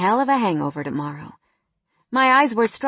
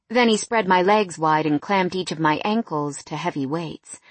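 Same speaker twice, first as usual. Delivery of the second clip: ordinary delivery, nothing marked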